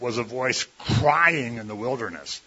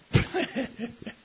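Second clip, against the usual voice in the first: laugh; 11 semitones higher, 4 dB quieter than usual. Real, not acted. Laughs at own joke.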